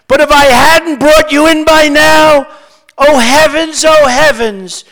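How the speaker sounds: loud, high recited cry. A narrator's reading. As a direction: cry it out in verse, easing down at the end. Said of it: "she cried".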